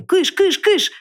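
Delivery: громкий высокий крик «кыш»; отгоняй зверя изо всех сил; грозный крик. A narrator's reading. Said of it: "грозно крикнул он". (громко и грозно прогнать)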